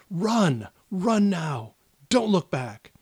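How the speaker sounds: urgent shout. Acted. Father shouting at his child to run, urgent. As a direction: push the child away, urgent and pressing. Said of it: "your dad just turns to you and says".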